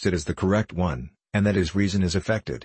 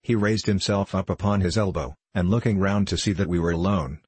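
plain reading voice

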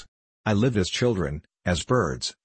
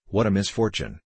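usual speaking voice

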